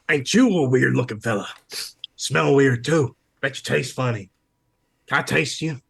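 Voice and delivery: cheeky hungry seagull. Acted. Seagull to a weird looking fella, cheeky bird voice. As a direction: size up a stranger as a possible snack. Game master plays the seagull.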